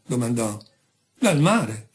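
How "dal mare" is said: ordinary delivery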